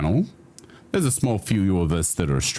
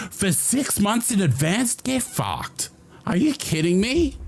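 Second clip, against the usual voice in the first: much higher, stunned outcry; can't believe the gift; shocked disbelief; 12 semitones higher than usual. Real, not acted. Shocked at big subscription.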